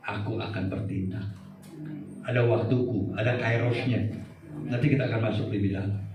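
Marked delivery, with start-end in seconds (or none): 0.1-1.3 much lower and softer; quiet solemn God voice. Acted. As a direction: speak God's promise in hushed weight. God speaking, quoted. (Tuhan berfirman pelan dan berat)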